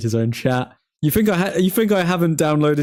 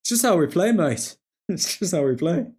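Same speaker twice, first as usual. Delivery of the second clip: light Australian voice, laughing; a loose, chummy Aussie plea for the boss; a hint of Ange's Australian accent pleading not to be sacked; imitating Ange. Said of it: "because he says, "Mate".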